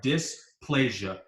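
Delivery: dysplasia, stretched out slowly syllable by syllable; slow spelled-out word. Shown as a demonstration. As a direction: sound out dysplasia slowly for the class. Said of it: "this is what it sounds like".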